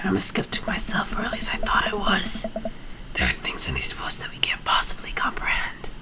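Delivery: hushed, mysterious warning; turning into whisper. Acted. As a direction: let the hero voice fade into breath. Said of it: "I'm turning into a whisper now".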